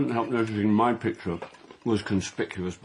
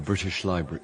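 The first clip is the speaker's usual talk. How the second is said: ordinary delivery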